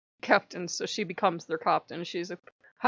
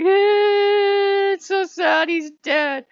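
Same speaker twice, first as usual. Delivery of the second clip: high, loud, drawn-out wail; exaggerated loud crying voice; mock wailing; 13 semitones higher, 13 dB louder, much slower than usual. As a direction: wail it like a tragedy, hammed-up. Retells upset reaction to story.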